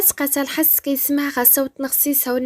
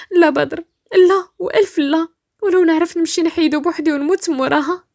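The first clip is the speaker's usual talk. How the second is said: higher, louder adamant refusal; insistent; 4 semitones higher, 5 dB louder than usual. Acted. Rania refuses Badr, fearing her strict family, insistent. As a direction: insist no, with rising urgency. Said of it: "ردات رانيا باصرار".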